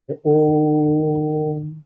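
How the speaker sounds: ओम held very long and slow, like a chant; prolonged om. Shown as a demonstration. Teacher demonstrates pluta vowel to class, long drawn-out om. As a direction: stretch the ओम over several beats. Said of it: "बीच में जो मैंने ये तीन, चार, पांच मात्राएं".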